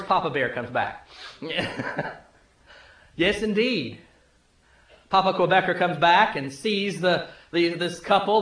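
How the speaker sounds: ordinary delivery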